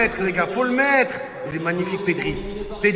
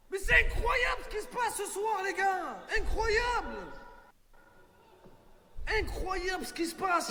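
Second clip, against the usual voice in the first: disbelieving cry; 8 semitones higher, 10 dB quieter than usual. Real, not acted. Disbelief at the match.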